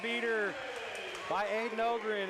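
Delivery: thrilled buzzer beater call; excited. Real, not acted.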